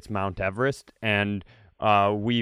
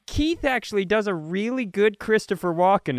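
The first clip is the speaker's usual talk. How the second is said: higher, eager party-guest voice; gushing introduction of a friend's impression; mock dinner party guest; 8 semitones higher than usual. Introducing Keith at a dinner party, hammed-up. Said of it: "somebody goes".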